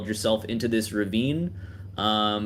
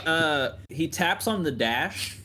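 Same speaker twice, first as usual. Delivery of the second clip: usual speaking voice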